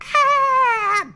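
very high, loud acted scream; mock death scream, held high; bloodcurdling scream. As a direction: let out a long piercing shriek. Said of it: "gurgled, like, bloody, curdling scream".

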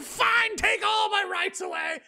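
high, shouted mocking whine; mock surrender. Mocking viewers who give up their rights, shrill mock shout. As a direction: squeal it like a pushover giving everything up. Mocking a gullible person giving in.

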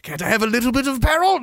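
high mock-plaintive voice; pleading knight. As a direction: beg hopefully in a high comic voice.

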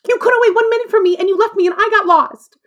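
high, loud tearful yelling; angry shout. Retold or imitated. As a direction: let it all burst out at them. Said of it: "I just snapped".

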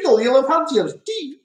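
high-pitched put-on Scottish accent; exaggerated Scottish impression; mock Scottish accent. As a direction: do a hammy Scottish catchphrase, pitched well up. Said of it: "I can do that one".

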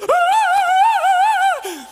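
loud, high sustained wail; imitated child's scream. Baby whose hair is pulled, imitated piercing scream. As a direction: let out a long high shriek. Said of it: "Enzo's just like".

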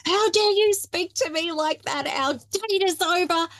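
angry outburst. Retold or imitated. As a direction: play the offended date snapping back, mock outrage. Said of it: "He hit the table and he's like".

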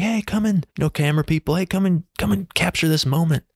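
high-pitched, eager retold call. Waving cameramen over to film.

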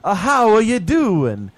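high, loud, slow hammed-up greeting; mock greeting. Greeting the Italian guest with a loud, slow, hammed-up hello. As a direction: stretch it out like talking to a foreigner. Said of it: "I would be like".

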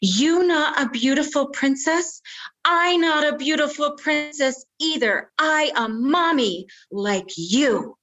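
put-on high, stubborn toddler voice; bossy little princess. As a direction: stamp it out like a defiant three-year-old. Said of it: "stomped her foot and said".

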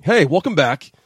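bright, loud welcome back; loud greeting. Real, not acted. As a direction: call out the welcome. Host greets audience on air.

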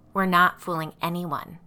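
usual speaking voice

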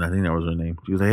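normal talking voice

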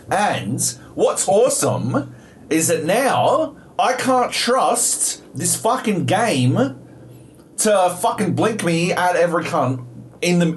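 slow, high-pitched, emphatic complaint; sarcastic exasperation. Real, not acted. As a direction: drag out each grievance in disbelief. Sarcastic rant about distrusting the game.

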